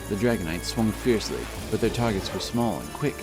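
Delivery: ordinary delivery, nothing marked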